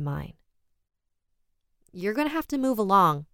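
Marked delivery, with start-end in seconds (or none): 1.9-3.2 higher, firm get-lost order; annoyed order. A narrator's reading. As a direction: shoo him off the doorstep. Telling the stranger to leave.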